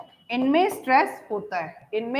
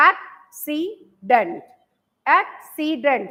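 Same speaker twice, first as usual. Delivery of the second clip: "Accident" slowly, एक - सी - dent with clear gaps; three separate slow beats on "accident"; split into syllables; much slower than usual. Shown as a demonstration. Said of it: "तीन breaks है ना". ("Accident" को धीरे-धीरे, तीन break देकर)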